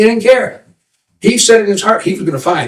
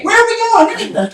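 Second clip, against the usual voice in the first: high-pitched relayed call. Acted. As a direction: pass the question up the line. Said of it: "they call out".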